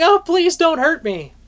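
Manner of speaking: mock scream. A narrator's reading. Dhampir girl grabbed by Azriel begs for mercy, mock scream. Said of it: "started screaming".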